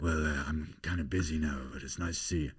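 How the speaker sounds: low, soft, evasive; polite film star. Acted. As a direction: brush off the fan gently in a hushed tone. Film star brushing off fan.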